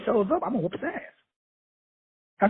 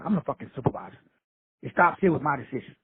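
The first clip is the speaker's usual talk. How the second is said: ordinary delivery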